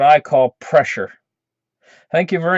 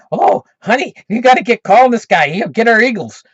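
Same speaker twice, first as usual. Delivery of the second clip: wife urging; 5 semitones higher, 4 dB louder than usual. Retold or imitated. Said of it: "she's saying".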